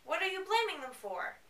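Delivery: high whiny Bella. Acted. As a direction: plead up high. Voicing Bella pleading.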